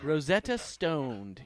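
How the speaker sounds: Stoned drawn out slowly with a hard final d; correcting pronunciation. Shown as a demonstration. Corrects the guess by stressing the d of Stoned. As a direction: slowly spell out Stoned, ending on the d. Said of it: "Uh, Rosetta Stone".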